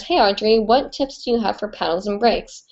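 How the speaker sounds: normal talking voice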